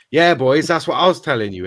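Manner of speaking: put-on loud, eager Scouse footballer rallying the boys; Steven Gerrard Scouse accent. Assistant backing the boss to the players, loud Scouse accent. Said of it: "in his thick Scouse accent".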